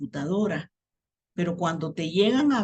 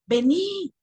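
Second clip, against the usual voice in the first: put-on kids yelling back; 10 semitones higher, much slower than usual. Children yelling back to their mother, high call. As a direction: shout it back like a small kid. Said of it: "ellos me respondían gritando".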